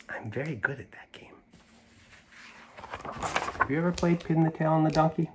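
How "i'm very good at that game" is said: whispered boast; whispering. Acted. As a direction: whisper it like a secret. Child boasts in secret.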